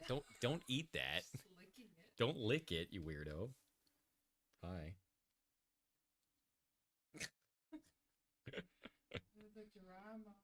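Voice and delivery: cooing to a pet. Real, not acted. Streamer cooing to his pet mouse while she sheds, soft baby-talk.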